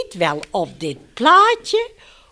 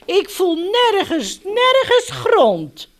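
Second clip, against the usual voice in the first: high, drawn-out distressed cry; storybook dog in distress; sad calling; 8 semitones higher, slower than usual. A narrator's reading. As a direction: plead sadly, pitched well up. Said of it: "riep hij verdrietig".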